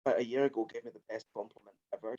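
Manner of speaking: ordinary talk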